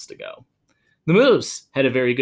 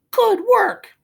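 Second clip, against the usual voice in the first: much higher put-on voice; Wilfred praising; 7 dB louder than usual. Wilfred praising his moose in a high put-on voice. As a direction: chirp it like a proud little owner. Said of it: "Wilfred says".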